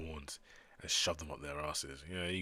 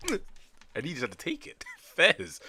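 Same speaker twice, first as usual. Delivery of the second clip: higher, words through laughter; laughing; 10 semitones higher, 5 dB louder, slower than usual. Real, not acted. Laughing at the scene.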